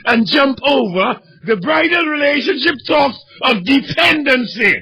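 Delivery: high, loud, cracking preacher's cry; impassioned pulpit shout; fiery preaching. Acted. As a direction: preach it out at full force. Preaching at full force.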